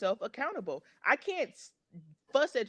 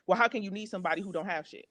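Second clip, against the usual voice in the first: ordinary delivery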